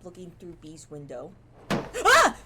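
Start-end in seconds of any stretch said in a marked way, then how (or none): 1.7-2.4 high, loud panicked cries; terrified shriek. Real, not acted. Player scared by window flying open, frightened shrieks. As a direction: scream and babble in real fright.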